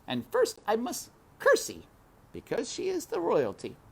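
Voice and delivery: put-on high country girl voice; Mig talking to herself. A narrator's reading. Mig rehearsing to herself, high voice. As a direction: rehearse it to yourself. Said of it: "muttering to herself".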